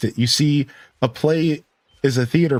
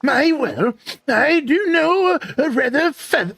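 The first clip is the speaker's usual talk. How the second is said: very high, doddering character voice; Merlin; 11 semitones higher than usual. Acted. Wizard recalls a fatherly joke, high character voice. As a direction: ponder aloud in a high put-on wizard voice. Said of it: "I do love me a good Merlin voice".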